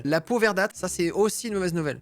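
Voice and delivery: ordinary talk, nothing marked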